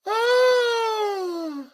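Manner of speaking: loud shout, a laugh, then a high shriek; ecstatic shouts. Real, not acted. Finding a rookie card in a random box, shrieks with joy.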